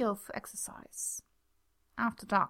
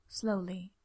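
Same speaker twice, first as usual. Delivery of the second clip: ordinary delivery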